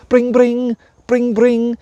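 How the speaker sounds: high chirpy phone ringing. A narrator's reading. Narrator mimicking the mobile waking the dragon, high and bright.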